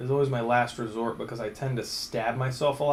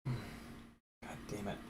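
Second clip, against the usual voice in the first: muttered curse; 5 semitones lower, 17 dB quieter, much slower than usual. Real, not acted. Frustrated under breath at repair.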